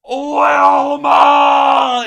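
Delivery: high, loud, drawn-out shout; cartoon holler. Calling out along to the Flintstones music, cartoon holler. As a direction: holler the name long and high. Imitates Flintstones yell over clip.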